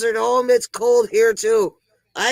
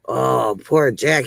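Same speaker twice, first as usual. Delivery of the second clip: ordinary talk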